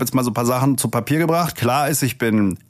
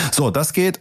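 ordinary talk

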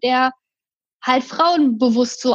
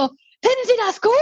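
mimicking the man; 9 semitones higher than usual. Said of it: "Also wirklich in dem Ton".

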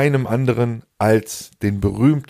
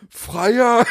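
high-pitched mock shout; jeering call, much higher than normal talk; loud call; 12 semitones higher than usual. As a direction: imitate lads yelling after someone, pitch shooting up. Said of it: "rufen die einfach ganz laut".

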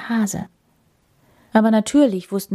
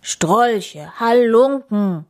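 louder, drawn-out scolding; bear's roar; 4 semitones higher, 5 dB louder, much slower than usual. A narrator's reading. Bear exposing the intruders, drawn-out angry roar. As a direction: bellow the insults slowly, moderately loud. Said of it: "brüllte der Bär".

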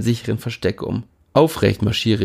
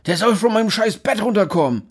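angry roommate; 11 semitones higher than usual. A narrator's reading. Niklas furious about his bed.

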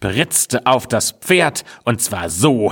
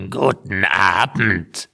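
slow, rasping greeting; rasping old witch; slower than usual. A narrator's reading. Old witch greeting the strangers with a rasp. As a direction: rasp out a feigned welcome. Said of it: "schnarrte sie".

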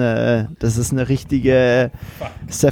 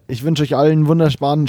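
ordinary delivery

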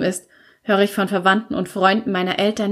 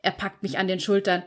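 usual speaking voice

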